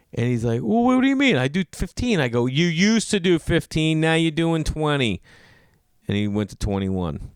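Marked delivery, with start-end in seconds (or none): 0.6-2.2 high, defensive customer voice; mock bewilderment. Challenged tipper defending himself. 2.5-5.2 slow, higher-pitched ultimatum; mock insistence. Comedian laying down law to tipper.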